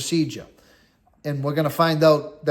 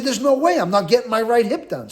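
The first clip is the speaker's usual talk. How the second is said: raised, higher protest of disbelief; indignant protest; 6 semitones higher than usual. Retold or imitated. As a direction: refuse it outright, voice up high. Protesting to staff about mistake.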